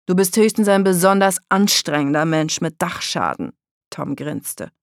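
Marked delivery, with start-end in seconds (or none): 0.1-3.5 put-on loud, taunting boy; mocking jibe. A narrator's reading. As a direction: taunt with a grin. Tom verspottet Daka, grinst. (laut und höhnisch)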